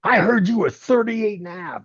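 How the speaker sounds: high-pitched, agitated accusation; imitating a worked-up boss; retold outburst. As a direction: confront him, voice way up but not shouting. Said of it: "Cornette comes flying out".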